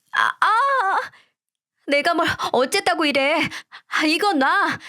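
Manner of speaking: high strained cries; pained outcry. A narrator's reading. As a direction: cry out as she's dragged. Wife in pain being dragged. (끌려가며 내지르는 고통의 외침)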